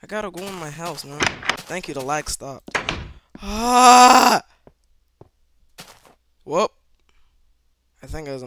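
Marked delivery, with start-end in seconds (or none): 3.4-4.4 loud, high cry of fright; startled scream. Real, not acted. Gamer startled on the way into his house, crying out in fright. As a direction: yelp out loud in alarm.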